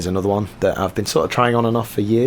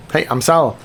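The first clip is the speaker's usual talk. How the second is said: ordinary talk, nothing marked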